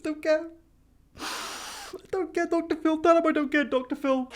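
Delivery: daytime-TV guest in a bad American accent. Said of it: "That was not a good American accent at all".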